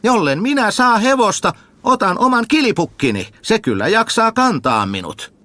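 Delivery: high, eager simpleton voice; a high-voiced village fool asking questions; Tuhma Jussi asking. Jussi answers father.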